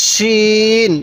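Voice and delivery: শিন with a thick sh, vowel held long; teacher's slow, thick-sounded শিন; thicker শিন. Shown as a demonstration. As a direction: draw out শিন on a level note with a full sh. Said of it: "শিনটা একটু মোটা করে উচ্চারণ করব".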